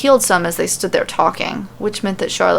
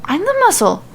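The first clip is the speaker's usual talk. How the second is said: startled; 11 semitones higher than usual. Acted. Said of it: "with a startled gasp".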